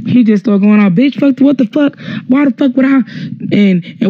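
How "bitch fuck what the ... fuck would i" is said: imitating a man flipping out, higher pitch; mock cussing-out, not shouted; retold angry outburst. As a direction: rant it back in a raised pitch. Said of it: "He flips the fuck out".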